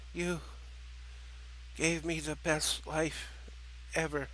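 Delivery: tender last words, faint; gentle dying words. Acted. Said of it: "Gloria smiled gently".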